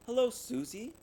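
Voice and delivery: bright, high hello; a cheerful sing-song hello; bright greeting. A narrator's reading. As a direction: greet her with cheer, pitch up. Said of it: "she said brightly".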